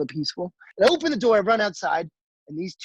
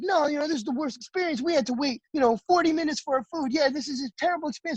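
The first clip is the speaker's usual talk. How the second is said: high, hurried customer gripe; mock complaining; 8 semitones higher, faster than usual. Unhappy diners griping about the wait, mock complaining. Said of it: "they were complaining that service took too long".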